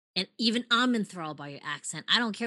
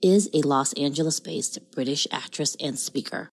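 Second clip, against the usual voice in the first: low, louder seductive announcer read; sexy announcer voice; 3 semitones lower, 6 dB louder than usual. Acted. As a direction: drop an octave and sell it. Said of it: "your sexy announcer voice".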